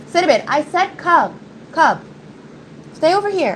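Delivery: high-pitched voice for a disobedient dog; high, insistent pet commands; calling the dog. Real, not acted. Ordering her dog to come.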